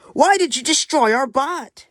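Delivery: high, loud indignant question; accusing demand. Acted. Penguin confronts the aliens over the wrecked bot, indignant. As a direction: demand an answer, outraged.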